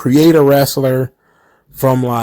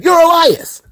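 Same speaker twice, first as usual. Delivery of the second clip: mock Kevin Owens. Kevin Owens quoted, much higher.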